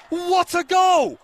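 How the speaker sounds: high, slow, roaring shout; goal yell. Real, not acted. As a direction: stretch it out, roaring. Commentator calls a long-range goal.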